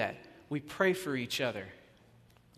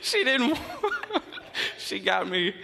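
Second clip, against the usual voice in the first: high, slow, laughing through the words; big laugh; 12 semitones higher, 4 dB louder, much slower than usual. Real, not acted. Pastor laughing at being mistaken for Quinn, high and slow. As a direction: crack up and barely get the words out.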